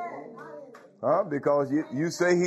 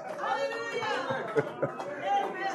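breathy high laugh; 7 dB quieter than usual. Real, not acted.

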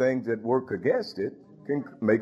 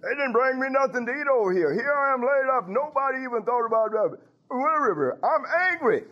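higher, complaining rant; hammed-up aggrieved complaint; venting anger; 10 semitones higher than usual. Preacher imitating Charlene venting her anger at him. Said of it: "Charlene was venting her anger".

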